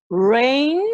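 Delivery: "rain" loud and drawn out with an untrilled R; "rain" with R made in the throat, no trill; English throat R. Shown as a demonstration. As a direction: pull the tongue back for the R of "rain". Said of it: "así digo".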